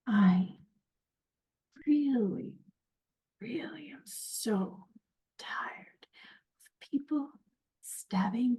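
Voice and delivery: slow, breathy threat with long pauses; fed-up threat. Acted. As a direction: space the words out, weary and menacing, into the attacker's face. Confronting an attacker face to face.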